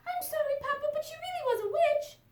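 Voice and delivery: high, eager little chick voice; little chicken voice. Acted. Chick asking her papa for a bedtime story, high and eager. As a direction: squeak it up like a small excited chick.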